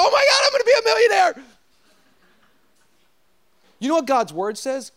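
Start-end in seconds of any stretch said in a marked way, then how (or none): 0.0-1.4 very high, loud, fast excited shout; giddy daydream. Retold or imitated. Mocking people's dreams of riches, hammed-up excited shout. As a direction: squeal over a fantasy future. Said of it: "makes us go".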